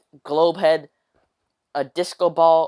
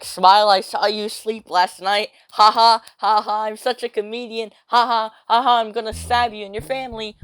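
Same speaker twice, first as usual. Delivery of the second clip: hammed-up, higher-pitched creep with fake laughs; mock creepy fan; 4 semitones higher than usual. Weird fan messaging him about watching him sleep, hammed-up creepy. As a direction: overplay a gleeful stalker.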